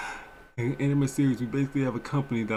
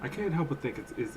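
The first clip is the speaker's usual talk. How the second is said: ordinary delivery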